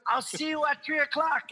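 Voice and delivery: high-pitched Jamaican sign-off; Jamaican accent. Retold or imitated. FedEx driver arranging a pickup, Jamaican accent. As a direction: call a goodbye in a Jamaican accent. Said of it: "the Jamaican guy goes".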